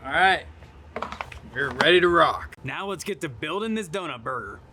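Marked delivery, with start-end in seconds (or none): 0.0-2.6 loud pumped-up call; jolly loud grill dad. Real, not acted. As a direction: hype it up loudly. Pumped to build the burger.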